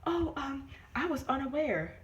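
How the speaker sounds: high, fake-innocent voice; mock innocent. Mimicking the doctor feigning ignorance, high and innocent. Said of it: "She was like".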